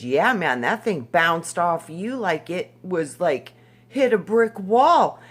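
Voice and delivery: loud, emphatic imitation of an amazed man; animated, punched-up quote; retold amazed reply. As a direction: retell it big, climbing loudly to the last words. Retells client's amazed reply.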